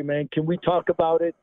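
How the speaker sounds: ordinary delivery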